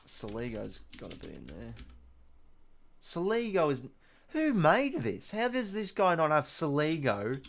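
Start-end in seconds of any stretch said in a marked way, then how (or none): 3.0-7.4 higher, incredulous protest at the list; you can't believe they left your favourite out; real exasperated disbelief, pitched up; outraged disbelief. Real, not acted. Streamer outraged at missing player.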